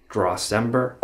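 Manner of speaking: usual speaking voice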